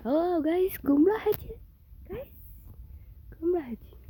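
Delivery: showing the viewers a pumpkin, thrilled and high; excited. Real, not acted. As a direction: pitch way up with delight at the pumpkin. Finding a pumpkin on the roof.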